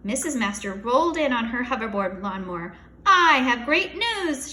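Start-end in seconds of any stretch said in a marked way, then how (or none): 3.0-4.5 slow, loud big announcement; announcing. A narrator's reading. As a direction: announce it grandly. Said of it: "she announced".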